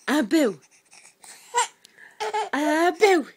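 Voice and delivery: loud, high burst of play; playful peekaboo call. Real, not acted. Mum playing boo with baby, high playful shout.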